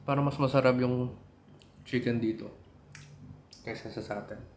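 eating and speaking casually; chewing while talking. Real, not acted. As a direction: mouth partly full of food. Seen 0: Bites chicken before; lips pressed, jaw working during words while holding chicken.

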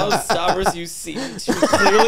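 high-pitched, laughing through every word; laughing embarrassed. Real, not acted. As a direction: caught out, giggling helplessly. Caught out by friend's teasing.

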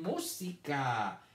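música drawn out very slowly; slow, drawn-out dictation. Shown as a demonstration. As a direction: stretch música out. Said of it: "La segunda palabra de hoy es".

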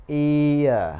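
ear said clearly, gliding from i to schwa; clear diphthong ear. Shown as a demonstration. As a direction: glide ear fully, not clipped. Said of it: "ear chứ không đọc là ear". (đọc rõ "ear", lướt trọn từ i sang ơ)

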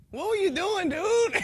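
high, loud alarmed question; mock startled friend. [name] yelling at the revived man, high and alarmed. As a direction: toss it up like a startled buddy.

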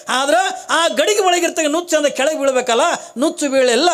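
normal talking voice

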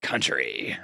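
low whispered gruff repeat; Snake voice. Pretend. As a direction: breathe it like Snake. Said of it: "I have a good time doing Snake voice".

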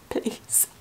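barely audible breathy whisper; hushed, pleading whisper; whispered plea. Pretend. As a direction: whisper it like a desperate wish. Begging for Nick to appear.

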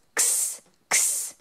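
whispered "X, x" as a breathy ks hiss; x sound. Shown as a demonstration. Teacher to children, a whispered "x" ks sound. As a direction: hiss "x" softly like ks. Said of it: "the X sound".

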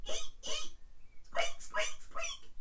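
tiny high squeaky rat noises; rat squeak. Shown as a demonstration. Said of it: "So now we need a".

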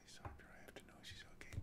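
whispered, fast; whispered reading. Acted. Reader whispering the script's tense moment, hushed. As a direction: breathe the lines out under your breath.

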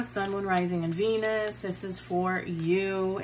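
ordinary talk, nothing marked

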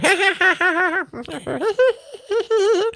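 high, far-off women's cackle; distant cackle. Acted. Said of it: "off in the distance here".

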